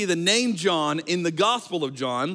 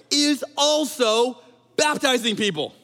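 mock indignant; 6 semitones higher, 4 dB louder, slower than usual. Acting out disciples' complaint.

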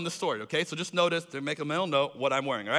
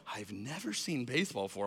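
much lower and softer disbelieving repeat; stunned quiet; 8 semitones lower, 11 dB quieter than usual. Retold or imitated. Embarrassed friend echoing Tim's claim, stunned quiet mutter.

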